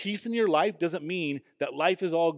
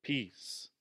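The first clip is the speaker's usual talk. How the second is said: "peace" set apart after a pause, softly; a soft, isolated "peace"; emphasis on "peace"; 8 dB quieter than usual. Shown as a demonstration. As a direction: let "peace" land alone, quiet and falling. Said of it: "emphasizing that word".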